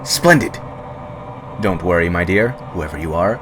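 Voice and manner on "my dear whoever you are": plain reading voice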